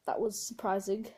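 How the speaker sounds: ordinary delivery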